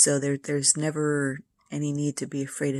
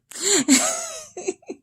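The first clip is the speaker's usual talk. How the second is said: laugh; 6 dB louder than usual. Real, not acted. Laughs at cat and husband.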